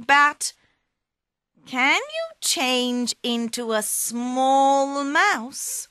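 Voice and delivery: flatter, then spring the question; sly cat. Acted. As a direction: Puss tricking the ogre into becoming a mouse. Puss tricks the ogre.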